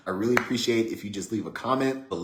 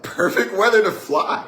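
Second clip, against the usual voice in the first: high, loud mock little-kid excitement; little sister voice; 11 semitones higher, 9 dB louder than usual. Voicing little sister with plane.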